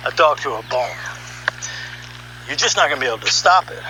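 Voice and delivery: ordinary delivery, nothing marked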